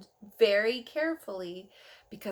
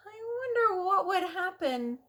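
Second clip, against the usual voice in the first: tempted musing; 9 semitones higher than usual. Acted. Tempted narrator eyes the button.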